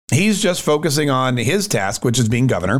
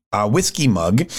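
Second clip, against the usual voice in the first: usual speaking voice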